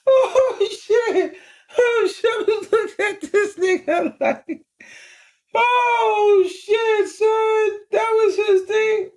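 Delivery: high-pitched, helpless laughter through the words; real laughing fit. Real, not acted. Cracking up at the driver's threat, real high-pitched laughing. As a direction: crack up while trying to talk. Cracks up at his own story.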